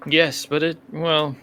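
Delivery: ordinary talk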